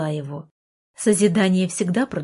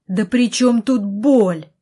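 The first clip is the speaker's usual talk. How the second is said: loud, higher, drawn-out outburst; angry yell; 4 semitones higher, 6 dB louder, slower than usual. A narrator's reading. Brother in wheelchair yells at his sister, angry. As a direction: cut in with a raised, indignant voice. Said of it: "заорал я".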